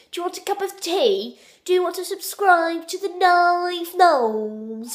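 high, hammed-up posh English accent; mock posh English. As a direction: put on a silly high-pitched posh English voice. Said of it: "You think we sound like this".

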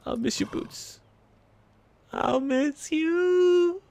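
mock sad farewell.

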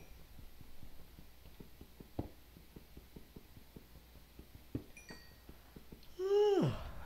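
long rising yawn; big yawn. Real, not acted. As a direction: give a huge exaggerated yawn. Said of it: "Uh, yawn".

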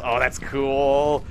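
usual speaking voice

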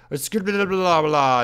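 high, hammed-up gibberish babble; Grazer. Showing another player how Grazer talks, hammed-up gibberish. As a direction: babble loudly in a put-on mock voice. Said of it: "Grazer would be like".

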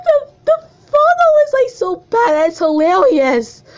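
very high, helpless laughing voice; laughing hard. Real, not acted. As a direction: squeeze the words out through real laughter. Cracking up over hilarious photo.

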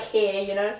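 ordinary talk, nothing marked

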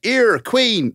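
hammed-up Northern vowels, very high and loud; "Ear a queen" with a dropped h, in a mock Northern accent. Mocking the boy from Wakefield, mock Northern accent. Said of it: "elocution lessons like Pygmalion".

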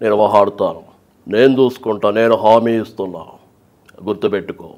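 low, calm assurances; very cool Naidu. Shown as a demonstration. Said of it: "చాలా కూల్గా ఉంటది".

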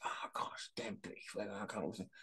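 hushed, breathy mock moan about British weather; whingeing foreigner. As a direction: whisper it like a fed-up visitor grumbling. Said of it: "And they're like".

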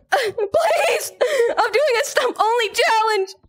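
frantic high yelling at a tagger; 1 panicked pleading shout. Real, not acted. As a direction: shriek to get the chaser off you. Kid begging chaser in game.

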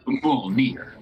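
Mjolnir said as "MOOL-neer"; character's odd pronunciation. Retold or imitated. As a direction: say Mjolnir with an "oo" vowel and no y-glide. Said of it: "one of the characters pronounces it".

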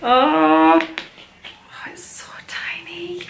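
breathless, high whisper of awe; hushed and high, barely voiced; awed whisper. Real, not acted. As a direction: gasp it out, overcome by how tiny. Baby clothes, overcome.

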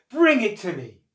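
high, loud order; commanding wife. A narrator's reading. Crocodile's wife ordering husband to fetch the heart, commanding. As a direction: demand it forcefully.

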